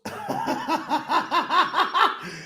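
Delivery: long, high rattling laugh; real helpless run of high chuckles; cracking up. Real, not acted. Host cracking up at listeners' votes, high laughing fit.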